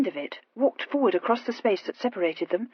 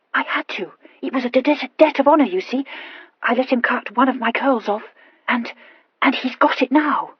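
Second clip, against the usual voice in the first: higher, louder, stumbling over consonants; stammering Horatia; 5 semitones higher, 8 dB louder than usual. Acted. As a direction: confess eagerly with a nervous stammer. Horatia confesses.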